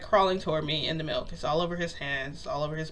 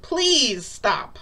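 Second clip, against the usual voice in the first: louder, higher plea to stop; begging; 5 semitones higher, 4 dB louder, much slower than usual. Retold or imitated. Woman begs him to stop licking her, pleading. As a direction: plead insistently, stressing each please. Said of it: "you're begging me then".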